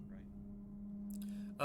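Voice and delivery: breathy whispered right, high; whispered question. Real, not acted. Player asks quietly about airlock.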